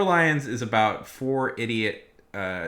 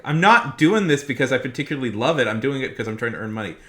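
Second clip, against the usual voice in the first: indignant reply; 4 semitones higher, 9 dB louder, faster than usual. Retold or imitated. Retort to the customer.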